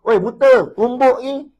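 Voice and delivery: loud, high brash threat; mock rude shout at an old man. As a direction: bark it like a cocky young thug. Youth bumping old man, shouting.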